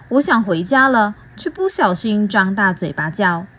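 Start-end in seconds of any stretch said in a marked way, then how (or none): none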